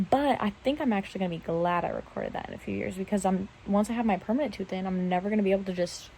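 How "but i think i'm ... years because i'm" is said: ordinary delivery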